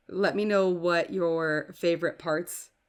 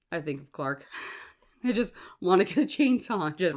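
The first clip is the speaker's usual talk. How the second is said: ordinary talk, nothing marked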